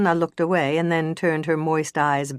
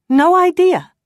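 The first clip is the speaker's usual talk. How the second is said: much higher, louder flat denial; insisting; 10 dB louder than usual. Acted. Injured woman insisting to the detective. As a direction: shut the question down hard. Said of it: "Sanna insisted".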